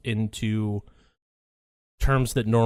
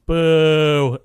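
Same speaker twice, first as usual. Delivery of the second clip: long, loud, higher boo; imitated booing; 4 semitones higher, 5 dB louder than usual. Retelling a spilled drink at a party, long mock boo. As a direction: draw out a heckling boo. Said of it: "booing people".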